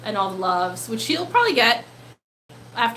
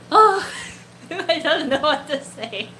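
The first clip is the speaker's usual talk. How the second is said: flustered laugh; 3 semitones higher than usual. Real, not acted. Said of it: "I'm really flustered now".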